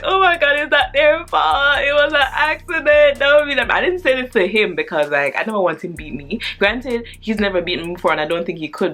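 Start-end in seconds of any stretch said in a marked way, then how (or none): 0.0-3.7 put-on high, louder, tearful kid voice; mock tearful protest. A child upset that her cousins get beaten, high and tearful. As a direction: plead through mock tears. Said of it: "low-key crying again".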